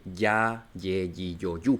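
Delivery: "ya, ye, yi, yo, yu" with a Y glide. Shown as a demonstration. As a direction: list "ya, ye, yi, yo, yu" low and soft, each starting with Y. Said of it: "La J suena como una Y".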